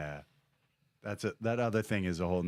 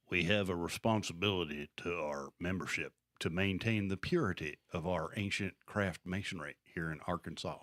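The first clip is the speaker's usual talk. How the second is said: put-on Southern accent; 3 semitones lower, 5 dB quieter than usual. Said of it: "we're so good at accents".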